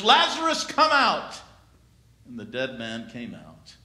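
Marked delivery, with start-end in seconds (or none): 0.0-1.4 calling out high and loud; commanding cry into the tomb. Acted. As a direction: call him out of the tomb with full voice. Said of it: "he cried out in a loud voice".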